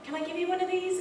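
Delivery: high, quick eager offer. Retold or imitated. Offering the tow driver a hug, high and quick. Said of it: "you know".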